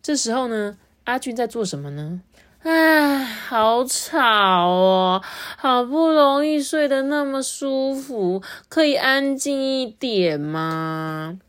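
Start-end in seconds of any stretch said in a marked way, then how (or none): 2.6-11.4 drowsy, slow complaint of a woken worm, pitched up; sleepy grumble. Acted. Worm Ajun woken from his nap, sleepy and annoyed. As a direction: groan it out like you were just dragged from sleep.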